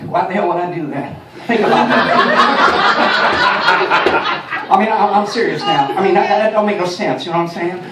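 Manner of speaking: folksy Texan president, laughing; laughing down-home president voice; George W. Bush impression. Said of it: "impression of George W. Bush".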